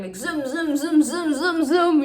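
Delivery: rising sung zooms; zoom sound effect. Retold or imitated. Reader imitating a car chase, sing-song zooms. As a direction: sing the car zooming away.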